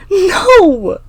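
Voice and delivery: high, loud, drawn-out refusal; scream. A narrator's reading. Emma refuses Clinton's dare, screaming. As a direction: scream it in anguish. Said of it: "I scream".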